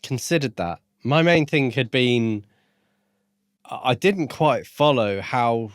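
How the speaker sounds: ordinary talk, nothing marked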